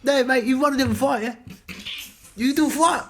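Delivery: put-on high, loud New Zealand accent; Kiwi accent. Mimicking Bale's character in a New Zealand accent, high and loud. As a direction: bluster it in a thick Kiwi accent. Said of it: "That's a pretty good Kiwi accent".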